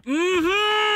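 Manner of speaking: high, loud "mm-hmm" breaking into laughter; delighted whoop. Real, not acted. Player getting plus five on second line, high delighted whoop. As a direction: let a humming cheer burst into a long laugh.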